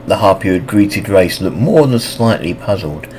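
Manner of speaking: usual speaking voice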